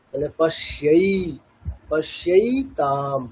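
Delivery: পশ্যই and পশ্যইতাম drawn out syllable by syllable, slow; slow recitation demo. Shown as a demonstration. Teacher reciting the Gita word slowly for students. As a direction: recite পশ্যইতাম like a chant line. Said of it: "ঐকার হলে শিয়ই".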